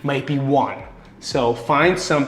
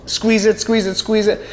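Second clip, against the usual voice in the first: each squeeze it pitched higher; demonstrating the squeeze; 6 semitones higher than usual. Shown as a demonstration. Coach calling squeeze reps to the viewers, pitched up. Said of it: "I'm gonna squeeze the shit".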